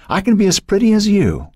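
put-on very high, childish defiance; insistent little girl. A narrator's reading. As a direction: boast to big sister in a little girl's voice. Young girl insists to her sister.

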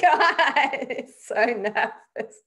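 nervous laughter, slow at the end; laughing. Retold or imitated. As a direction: laugh out the relief. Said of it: "I was like".

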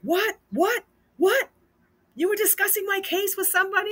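high, incredulous imitation of an angry kid; mock outrage. Inmate son confronts father over discussing his case, retold outrage. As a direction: blurt each shocked question high, acting it out.

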